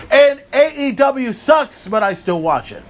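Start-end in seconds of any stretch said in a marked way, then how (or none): 0.1-1.9 mocking rant. Real, not acted. Ranting list of promotions.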